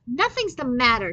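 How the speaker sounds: put-on girl snapping at her father; snapped. A narrator's reading. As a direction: bite the words off. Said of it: "she snapped".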